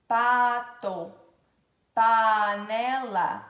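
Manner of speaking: "pato. Panela." loud, first syllable drawn out; stretched P words. Shown as a demonstration. Teacher giving P examples, loud and drawn out. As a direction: push out a long "pa" in each.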